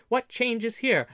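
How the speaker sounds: high, loud exclaimed question; amazed. A narrator's reading. Robin looking his kinsman over, amazed. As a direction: marvel aloud, voice jumping up. Robin marvels at grown nephew.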